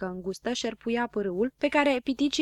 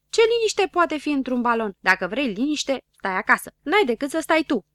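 loud, raised-pitch retort; quarrelling; 4 semitones higher, 6 dB louder than usual. A narrator's reading. Don Dănel argues with Habar n-am, quarrelling. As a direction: argue back indignantly.

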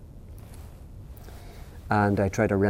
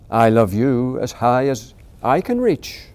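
big hare answering; 3 semitones higher, 6 dB louder, slower than usual. Acted. Big hare matching his son, slow calm father voice. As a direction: answer the child with easy warmth. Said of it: "said Big Nut Brown Hare".

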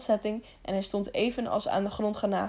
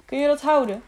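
ordinary delivery

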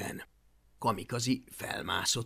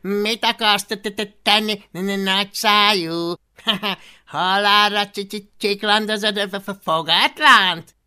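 high, loud stuttering dragon voice; Fogatlan's mocking laugh; 7 semitones higher, 13 dB louder than usual. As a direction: jeer with stammers and bursts of laughter. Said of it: "Fogatlan gúnyosan felkacagott".

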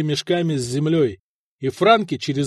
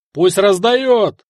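slow, loud, higher-pitched holler; yell from the crowd; 4 semitones higher, 6 dB louder, much slower than usual. A narrator's reading. Soldier demanding the silver be handed out, yelling. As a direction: yell it over the heads of a crowd. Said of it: "заорал кто-то в толпе".

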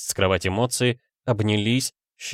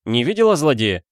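higher, pressing question; raised, insistent asking; 4 semitones higher, 4 dB louder than usual. A narrator's reading. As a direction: press the girl urgently. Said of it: "настаивал Адам Каэтан Петрович".

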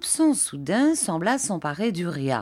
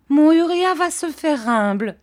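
higher, louder pleading cry; mother's cry; 10 semitones higher, 7 dB louder than usual. A narrator's reading. Mrs Heep pleading with everyone for her son, crying out. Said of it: "s'écria sa mère".

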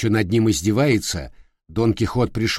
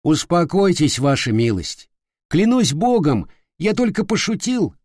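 higher, meek pleading; humble; 6 semitones higher than usual. Acted. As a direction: humbly beg him to calm down. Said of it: "и смиренно сказал".

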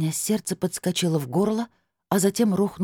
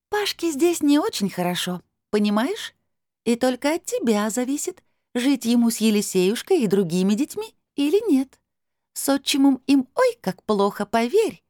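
high otherworldly pleading; persuasive crystal voice; 9 semitones higher, 3 dB louder than usual. A narrator's reading. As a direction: coax her from up high. Voice persuades heroine. (высоким голосом, убеждая)

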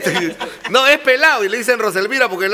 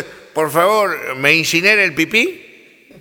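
put-on slow drunk request; 5 semitones lower, slower than usual. Drunk asks crematorium worker.

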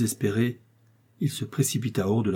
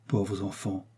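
soft, much quieter sigh of pity; tender pity; 8 dB quieter, slower than usual. A narrator's reading. Old marquis pitying the young lovers, soft and tender.

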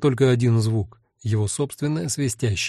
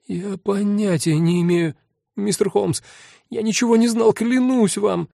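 high, panicky swearing innocence; pleading frightened man; 9 semitones higher than usual. A narrator's reading. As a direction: beg him to believe you.

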